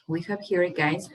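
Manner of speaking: ordinary delivery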